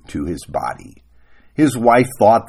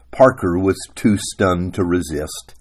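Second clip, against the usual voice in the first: ordinary talk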